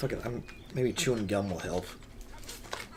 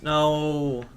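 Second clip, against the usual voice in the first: higher, drawn-out no; dismayed cry; 4 semitones higher, 4 dB louder than usual. Real, not acted. Gamer losing the blue ones, dismayed cry. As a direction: let out a long cry of dismay. Loses minions in the game.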